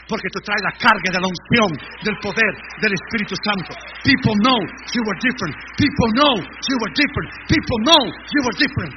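loud preacher's proclamation; shouting the refrain at full force; full-force preaching. Acted. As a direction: hammer it home to the church.

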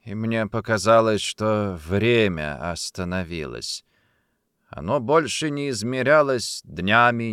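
plain reading voice

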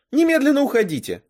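high, loud outraged command; indignant order. A narrator's reading. Girl orders intruders out of her room, indignant. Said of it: "возмутилась я".

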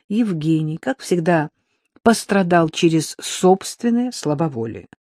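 plain reading voice

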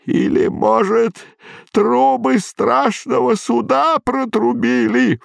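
put-on high, slow quavering old voice; a frail old man, lost and pleading. A narrator's reading.

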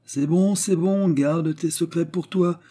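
high, drawn-out huffy brush-off; vexed old father, voice pitched high; piqued dismissal. Acted.

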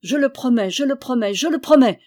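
hasty promise. A narrator's reading. Throttled knight gives in to his cousin, precipitate pleading promise. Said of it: "dit Méloir précipitamment".

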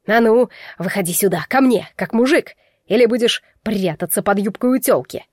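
belligerent call to fight; heated, taunting dare; angry challenge. Acted. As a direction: goad him to step out. Enraged rival challenges him to fight.

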